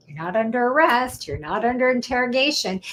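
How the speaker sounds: ordinary talk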